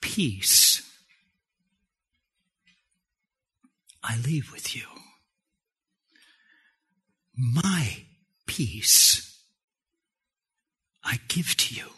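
low, hushed, with long pauses; Jesus gently leaving peace, softly spoken; solemn calm blessing. Acted. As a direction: bestow each phrase quietly and unhurriedly. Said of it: "that's exactly what Jesus is doing".

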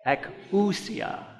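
Ekousia drawn out slowly with a rising end; Greek word taught. Shown as a demonstration. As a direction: model Ekousia slowly for the class to repeat. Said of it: "Say that with me".

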